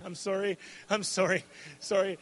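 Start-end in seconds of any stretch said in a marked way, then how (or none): none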